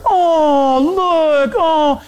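high, drawn-out imitation of cooing; cooing passerby. Next passenger spots the newborn, high mock cooing. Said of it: "Next person".

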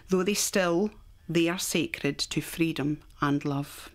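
reciting a poem slowly and carefully; slow, careful reading. Real, not acted. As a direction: take the verse slowly, word by word. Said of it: "So I'll go slow".

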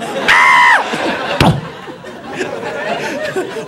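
very high squeal fading into wheezes; mock laughing fit running out of air; dying of laughter. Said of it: "he's dying".

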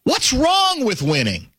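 high, slow swooping challenge; drawn-out, high-pitched quote without shouting; retold bellow, inflected. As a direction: stretch out WRONG in a mock bellow. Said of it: "let me do the inflection better".

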